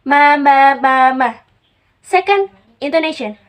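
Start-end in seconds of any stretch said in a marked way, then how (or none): 0.0-1.3 "ma, ma, ma, ma" as separate, loud syllables; tone demonstration. Shown as a demonstration. Lecturer showing tone to students, loud measured "ma, ma, ma, ma". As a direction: chant "ma, ma, ma, ma" loudly as a tone drill. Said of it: "Tone is produced because of pitch rhythm".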